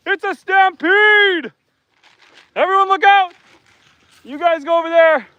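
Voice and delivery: half-joking alarm as the herd rushes in; excited warning. Real, not acted. Farmer warning viewers as animals rush the feed, high and excited.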